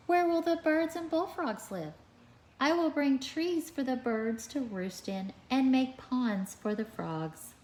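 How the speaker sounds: animated picture-book read-aloud; reading to children. A narrator's reading. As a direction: read it out to a class of little kids.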